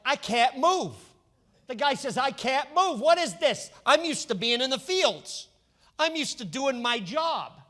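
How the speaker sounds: put-on a young shepherd fussing in armor he can't move in; mock complaint. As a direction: sound trapped and uncomfortable in the armor. David stuck in heavy armor.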